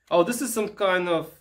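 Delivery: ordinary talk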